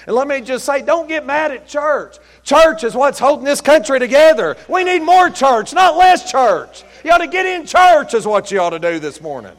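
impassioned pulpit shout; preaching at full force. Real, not acted. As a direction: drive it home to the congregation.

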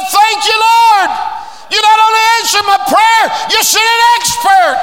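high, loud preaching shout; preacher shouting thanks to the Lord; shouting praise. Acted. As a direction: lift the roof with praise. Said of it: "She started shouting".

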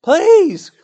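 high, loud, slow begging for the double share; whining Esau. Retold or imitated. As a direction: wheedle like a greedy child on please. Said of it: "This fool Esau, he wanted the double".

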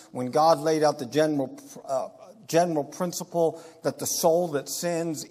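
ordinary talk

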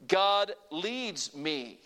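very slow, each word spaced; slow call to repeat. Shown as a demonstration. Preacher slowly leading the congregation's response. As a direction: draw it out so they can follow. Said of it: "Say it with me".

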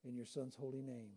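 soft, low, reverent prayer; hushed closing prayer after the sermon. Real, not acted. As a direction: pray quietly and humbly.

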